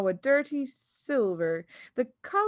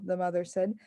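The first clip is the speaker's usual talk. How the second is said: ordinary delivery, nothing marked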